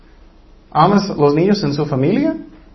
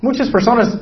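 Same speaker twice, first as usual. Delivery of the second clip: usual speaking voice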